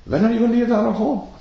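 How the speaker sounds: put-on high, fast childish demand; cheeky child. Child asks guest to leave, high fast cheeky voice. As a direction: push the question like a bold kid. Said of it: "goes to the guest, says".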